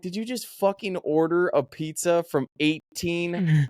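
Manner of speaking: slow, high-pitched disbelief; incredulous mock confrontation, stretched out; retold incredulity. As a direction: spell out the outrage, drawn out and high. Confronting friend over prank pizza.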